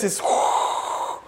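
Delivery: breathy whoosh of air from the open furnace; soft furnace whoosh; whispered whoosh of fire. Retold or imitated. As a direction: whisper the rush of heat. Imitating open furnace rush.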